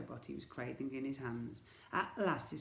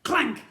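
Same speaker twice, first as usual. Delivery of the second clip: sharp high clank; high loud clank; 12 semitones higher, 15 dB louder than usual. Acted. Narrator sounding the robot hitting the floor, loud clank. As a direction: bang out the clank.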